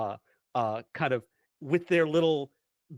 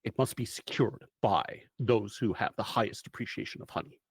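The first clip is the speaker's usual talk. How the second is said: lightly voiced, solemn mission briefing; measured storyteller laying out the mission; game master's briefing; 4 semitones lower than usual. GM scene briefing narration.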